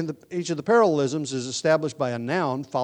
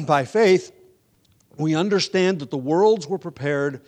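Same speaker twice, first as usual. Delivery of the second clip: scripture reading; 4 dB louder than usual. Acted. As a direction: read the verses out with weight. Preacher reads Hebrews aloud.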